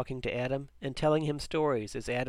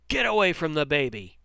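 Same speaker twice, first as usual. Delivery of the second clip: angry shout; 3 semitones higher, 6 dB louder than usual. A narrator's reading. Stepfather yelling at boy near the baby, angry shout. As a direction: shout it like an order to back off. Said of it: "George shouted".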